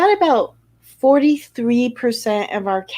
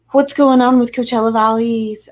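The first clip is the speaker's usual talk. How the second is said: usual speaking voice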